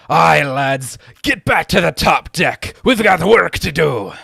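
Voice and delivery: loud, high sailor's rallying cry; rallying crewman voice. Acted. Crewman rallying his mates back to the top deck, loud sailor voice. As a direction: rouse the lads with a high, full-voiced shout. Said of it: "they all kind of mutter among themselves".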